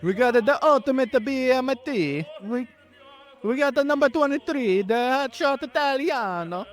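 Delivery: hammed-up Italian accent, pitched high. Host reading Subway sandwiches in a mock Italian accent. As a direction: read the menu like a cartoon Italian. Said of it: "I'm gonna read all these in the Italian accent".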